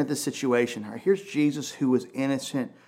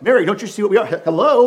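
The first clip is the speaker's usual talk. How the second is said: higher and louder, impatient; mock exasperated angel; 8 semitones higher, 11 dB louder than usual. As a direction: scold like a show-off angel. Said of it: "There's no, like".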